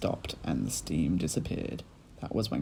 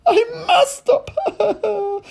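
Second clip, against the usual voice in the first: wail; 12 dB louder, much slower than usual. A narrator's reading. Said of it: "Missus Cranky wailed".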